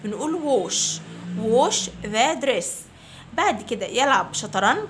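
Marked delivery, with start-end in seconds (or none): 0.7-1.0 wash said with an o vowel, wosh. Shown as a demonstration. Teacher showing the a in wash said like o. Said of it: "بننطقه زي ال O". 1.4-2.7 put-on very slow, clear drill phrase for children to repeat; slow model phrase. Shown as a demonstration. Teacher to fifth graders, model phrase very slowly. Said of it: "Repeat after me".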